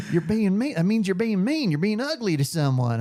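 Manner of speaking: mock Southern girlfriend defending herself; Southern accent. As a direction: play the girlfriend correcting him in a Southern lilt. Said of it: "I'm talking like it now".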